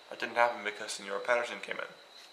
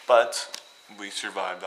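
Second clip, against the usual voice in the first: loud, slow but we survived; dramatic emphasis; 10 dB louder, slower than usual. Real, not acted. Joking to viewers about the trek, slow and loud. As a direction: draw it out like a hard-won victory.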